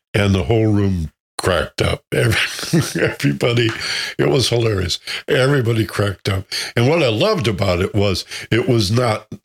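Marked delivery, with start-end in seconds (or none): none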